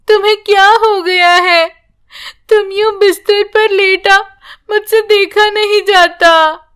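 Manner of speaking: put-on a small girl frightened by her sick grandma; worried little girl. A narrator's reading. Granddaughter at grandmother's sickbed, anxious childlike voice.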